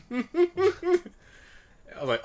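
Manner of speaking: high, slow laugh breaking in. Real, not acted. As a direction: laugh it out mid-sentence. Laughs through retelling.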